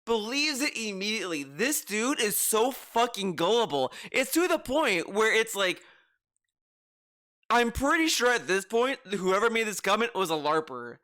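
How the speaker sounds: ordinary talk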